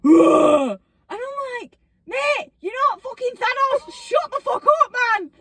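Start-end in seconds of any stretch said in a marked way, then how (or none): 0.0-0.8 loud mock exertion grunt; loud gym grunt. Mocking gym lifters who grunt, loud strained grunt. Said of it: "people who scream and grunt at the gym". 2.1-5.3 high-pitched mock outrage; exasperated rant. Gym-goer to grunting lifter, exasperated outburst.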